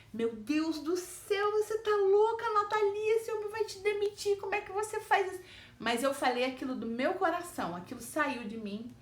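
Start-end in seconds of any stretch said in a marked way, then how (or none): 0.1-5.4 high alarmed thoughts, scolding herself; panicked inner voice. Retold or imitated. Her own mind panicking after confronting the boss. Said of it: "minha cabeça falou assim".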